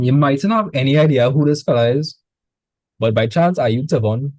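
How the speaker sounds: ordinary talk, nothing marked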